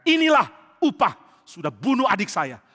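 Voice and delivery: vengeful. Acted. Joab speaks over Abner after stabbing him, vengeful. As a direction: savour the revenge.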